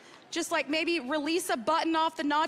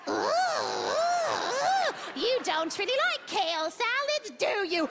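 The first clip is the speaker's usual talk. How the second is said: slow, high, creeping demon voice; tempting demon; 5 semitones higher, slower than usual. Pretend. The weight demon tempting her to skip kale, creeping taunt. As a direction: croon like a stalker in the shadows. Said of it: "a demon stalker waiting in the shadows".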